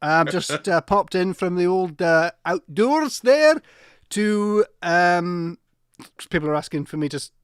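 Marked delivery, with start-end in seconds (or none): 0.0-5.6 loud, higher, slow Scottish cow; a cheery Scottish cow calling hello to James; Scottish accent as a cow, loud and slow. Pretend. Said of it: "I'm a Scottish cow".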